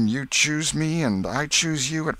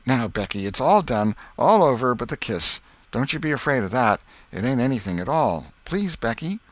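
put-on higher, louder boyish coaxing; pleading; 5 semitones higher, 5 dB louder than usual. A narrator's reading. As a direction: plead with her, lightly acted. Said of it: "clasped her about her neck and pleaded".

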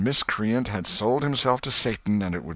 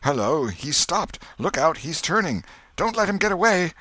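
rising alarmed calls, higher; alarmed crowd cries; 10 semitones higher, 4 dB louder than usual. A narrator's reading. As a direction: warn each other he might flee. Crowd calling warnings.

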